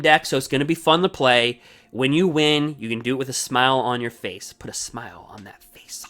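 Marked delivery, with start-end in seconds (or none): 4.5-6.0 hushed, toneless whisper of the Joker line. Retold or imitated. Streamer quotes the Joker in a creepy whisper. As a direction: breathe the line like a sinister villain. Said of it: "Heath Ledger, Joker".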